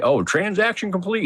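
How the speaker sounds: high-pitched mock machine announcement; mimicked device voice. Device heard in the middle of the night, mimicked announcement. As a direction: imitate a device chirping out a notice.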